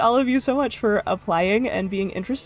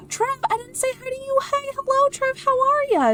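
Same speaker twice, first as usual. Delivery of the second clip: much higher, gasping cheerful greeting; excited hello; 14 semitones higher than usual. Real, not acted. Streamer suddenly notices viewer Trev, high excited greeting. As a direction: squeal a surprised welcome.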